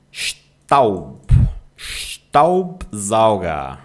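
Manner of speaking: STAUBSAUGER slowly, opening on a long SH; SH sound for S. Shown as a demonstration. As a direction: draw out Staubsauger as "shtaub-zauga". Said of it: "Letra S antes de uma consoante tem som de C". (Staubsauger devagar, com S inicial chiado)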